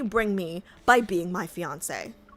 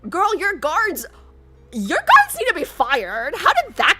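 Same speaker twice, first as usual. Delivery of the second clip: exasperated; 8 semitones higher, 6 dB louder than usual. Real, not acted. Scolds about useless guards.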